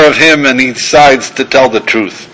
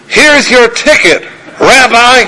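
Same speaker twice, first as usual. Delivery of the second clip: much higher, slow, drawn-out Southern drawl on "Rabbi"; hammed-up Southern drawl; 9 semitones higher, slower than usual. Trooper hands the ticket to the driver, hammed-up Southern drawl. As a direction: stretch it out, sweetly Southern and polite. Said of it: "in a Virginia Southern drawl".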